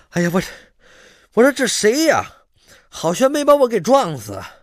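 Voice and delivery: pained outcry. Acted. Character bumped, cries out.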